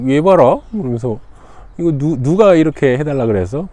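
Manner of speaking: high, loud startled question; surprised. Acted. Magistrate surprised by Gangrim's request, startled exclaim. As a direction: sound caught off guard and curious.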